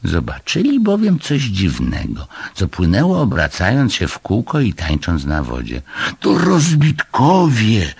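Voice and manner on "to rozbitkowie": high, loud, drawn-out cry; alarmed yell. A narrator's reading. Moomin spotting castaways in the flood, alarmed yell. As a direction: yell out the discovery slowly and high. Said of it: "wrzasnął Muminek".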